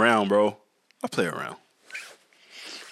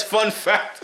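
mocking quote; 10 semitones higher than usual. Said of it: "He says".

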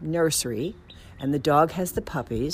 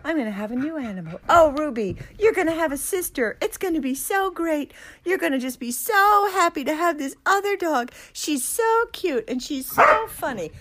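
put-on high, excited baby talk to the dog; gleeful; 12 semitones higher than usual. Telling Ruby about her new sister, high and gleeful. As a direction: bubble over with joy. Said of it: "I'm like".

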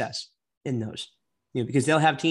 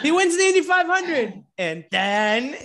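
put-on excited kid rambling; 10 semitones higher, 4 dB louder, slower than usual. Mock rambling story.

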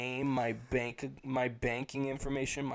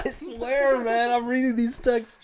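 laughing insistence; 9 semitones higher, 8 dB louder than usual. Real, not acted. Insisting while still laughing.

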